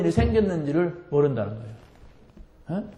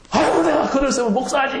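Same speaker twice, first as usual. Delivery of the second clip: high, fast, laughing brush-off; laughing quote; 9 semitones higher, faster than usual. Retold or imitated. Pastor retelling his friend's reply, laughing and high.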